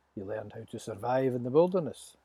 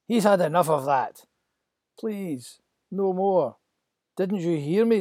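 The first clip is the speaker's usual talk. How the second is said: loud, raised pleading protest; angry Moses; 6 semitones higher, 8 dB louder than usual. Acted. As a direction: Moses shouting at the slave driver. Moses voiced angrily in story.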